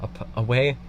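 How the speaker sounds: usual speaking voice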